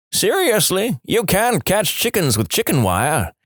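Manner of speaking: incredulous high-pitched retort; mock yell back. A narrator's reading. Old man scoffs at the kid's plan, mild mock yell. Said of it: "he yells back".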